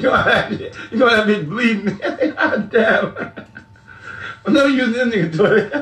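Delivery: breaking up with laughter between words; so amused he can hardly talk; laughing hard. Real, not acted. Teasing his friend about the toilet, laughing helplessly.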